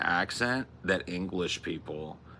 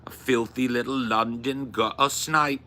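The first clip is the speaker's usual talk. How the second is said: loud, higher mock London accent; hammed-up London accent with dropped t's; London gutter snipe; 4 semitones higher, 5 dB louder than usual. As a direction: say it like a London street urchin. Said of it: "London gutter snipe is kinda cute too".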